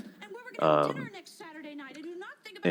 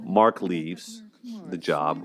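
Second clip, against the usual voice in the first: ordinary delivery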